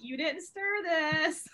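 3 draw out every word like a sly hint; 1 suggestive drawl. Retold or imitated. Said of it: "she's like".

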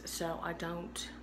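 whispered so as not to wake anyone; hushed whisper, husband asleep. Real, not acted. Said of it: "trying to talk not very loud".

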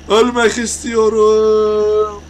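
theatrical fake death wish wail; mock anguished wail. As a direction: stretch ölmek istiyorum into a hammed-up cry. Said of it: "Biraz çıldırdım".

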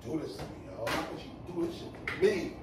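low, very soft dejected murmur; hurt lament. Real, not acted. Man laments to himself over his ex, hurt and dejected.

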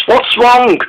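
lightly voiced worried engine; high worried call. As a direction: ask it high, like a worried friend. Dennis calling after Molly.

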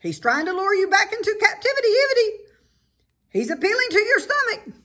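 high, loud goose squawking; frantic high goose alarm; goose warning. A narrator's reading.

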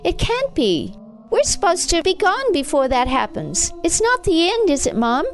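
put-on high, anxious child's voice; worried, pleading boy. A narrator's reading. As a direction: plead with your mother for reassurance. Boy panics, pleads with mother.

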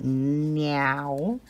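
"now" stretched slowly into "nee-ow"; extra syllable. Retold or imitated. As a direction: imitate a twangy two-syllable "now". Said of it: "like you're putting another syllable in there".

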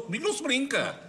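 tough-guy boast. Acted. Nico brags to the others about how tough he is, cocky. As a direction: Comigo não se brinca, thrown out proud and cocky. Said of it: "o tipo de pessoa que eu sou".

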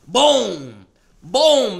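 loud thudding bung noises; punch sound effects. Retold or imitated. Punching the choker, loud bung bungs. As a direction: hammer each bung like a blow.

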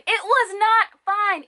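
high, slow, emphatic denial; exasperated and high, dragging each word; indignant, drawn out. Real, not acted. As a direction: stretch the words out in indignant protest. Repeats the verdict, drawn out.

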